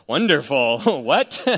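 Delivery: real incredulous laugh through the words. Real, not acted. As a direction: laugh it off in disbelief. Reacting to the ketamine news.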